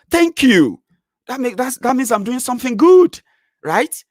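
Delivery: high, loud delight; elated. Real, not acted. Thanking fans for calling his work liberating, high delight. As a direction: crow with pleasure. Excited self-praise.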